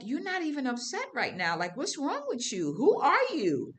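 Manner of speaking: mock-question yourself in amazement; incredulous at herself. Questioning her own calm.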